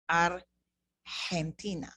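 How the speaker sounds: slow Argentina, G said as a clear h; slow Spanish Argentina. Shown as a demonstration. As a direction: spell out Argentina slowly, G as h. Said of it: "you are using this pronunciation for the letter G".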